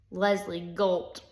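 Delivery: ordinary delivery, nothing marked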